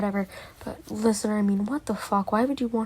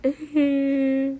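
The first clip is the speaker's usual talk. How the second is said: sad groan; 4 semitones higher, 4 dB louder than usual. Shown as a demonstration. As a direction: let out a droopy sad grunt. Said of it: "what noise is that supposed to be".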